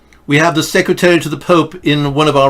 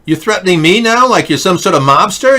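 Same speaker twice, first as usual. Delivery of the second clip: raised indignant voice; 6 semitones higher, 3 dB louder than usual. Real, not acted. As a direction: push back hard, high and loud. Confronting chatter, raised voice.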